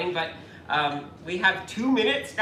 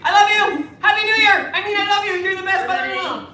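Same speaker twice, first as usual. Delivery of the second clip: much higher, louder loving shout; loud affectionate shout; 9 semitones higher, 7 dB louder than usual. Real, not acted. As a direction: shout your love across. Shouting love to mother-in-law.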